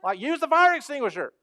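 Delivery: fed-up guy pointing at the extinguisher, exasperated mock shout. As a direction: yell in disbelief, high and loud. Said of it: "And he's like".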